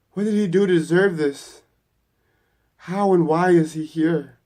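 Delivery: loud, high-pitched panicked questioning; panicking yell. A narrator's reading. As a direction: demand an answer in rising panic. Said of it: "he starts panicking, yelling".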